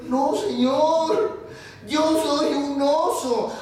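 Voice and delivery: high, long drawn-out plea; protesting bear. Acted. Bear contradicting the angry foreman, plaintive insistence. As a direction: wail your denial slowly.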